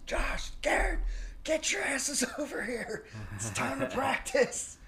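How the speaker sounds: high, strained mock yell; hoarse, high-pitched imitation of a screaming coach. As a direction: imitate a coach hollering kids over to practice. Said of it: "he'd start screaming at us".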